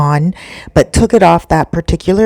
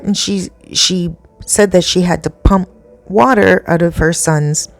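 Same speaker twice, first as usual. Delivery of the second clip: usual speaking voice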